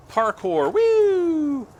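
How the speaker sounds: high, loud cheer; excited whoop. Real, not acted. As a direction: whoop it out while leaping. Jumping around in game.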